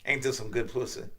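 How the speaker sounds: low, soft bedroom voice; seductive lover. Acted. Kim coaxing Bobby in bed, low and soft. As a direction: coax it quietly, low in the voice.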